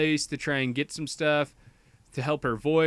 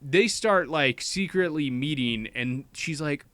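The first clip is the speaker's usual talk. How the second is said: ordinary delivery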